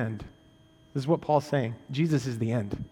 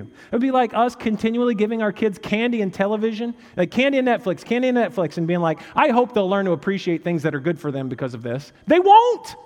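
louder, much higher, driving sermon climb; full-force preaching; 7 semitones higher, 6 dB louder than usual. Acted. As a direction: preach it out to the whole room. Preaching the candy illustration.

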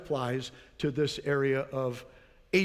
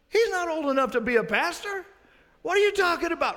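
mock incredulous; 11 semitones higher, 4 dB louder, faster than usual. Paraphrasing the older pastor's scorn.

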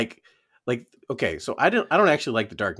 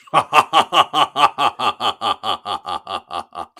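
evil chuckle; 6 dB louder than usual. Acted. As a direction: cackle like a cartoon bad guy. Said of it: "That was a very good bad guy laugh".

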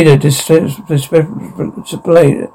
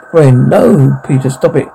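ordinary talk

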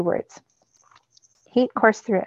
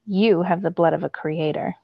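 plain reading voice

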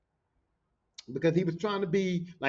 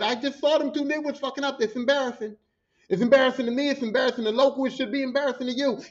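higher, indignant scolding; retold reprimand in a raised voice; imitated angry boss; 6 semitones higher than usual. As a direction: drive home the shame like a boss telling someone off. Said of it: "That's how he said".

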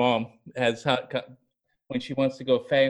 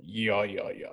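low, fading mock yeah-yeahs; a lightly done dismissive Russian official, dropping lower and softer; imitating the Russian minister; 7 semitones lower, 9 dB quieter than usual. Recapping the movie scene for the hosts, a low fading imitation of the minister. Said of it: "where he walks in".

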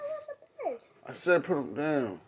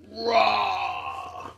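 put-on playful little monster roar for the baby; mock roar; 5 semitones higher, much slower than usual. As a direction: growl it out like a toy lion to get a giggle. Playful roar to make baby laugh.

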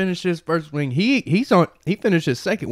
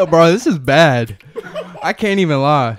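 laughing; 7 dB louder than usual. Real, not acted. Laughing through the burn.

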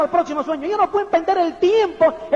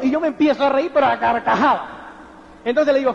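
normal talking voice

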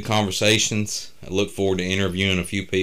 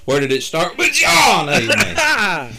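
high, loud hammed-up preacher shout; heroic rallying shout; 11 semitones higher, 6 dB louder, slower than usual. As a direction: whip up the congregation, yelling.

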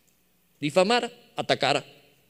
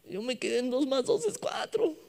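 small, high, softer voice; lost child; 8 semitones higher, 5 dB quieter than usual. Acted. Grandchild lost in grandfather's maths, high meek confusion. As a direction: squeak it like a bewildered pupil. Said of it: "y yo así de".

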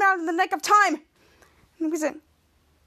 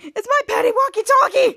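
excited shout; 8 semitones higher, 7 dB louder than usual. Acted. Kid Danger happy and excited.

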